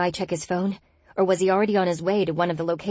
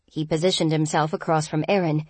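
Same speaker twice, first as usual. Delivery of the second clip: ordinary delivery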